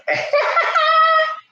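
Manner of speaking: a squealing burst of laughter; high laugh. Real, not acted. Laughing at ring mixup.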